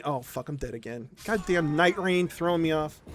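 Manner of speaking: low, hushed grumble to oneself; muttered aside. Real, not acted. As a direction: drop right down and grumble under the breath. Aside about dying in game.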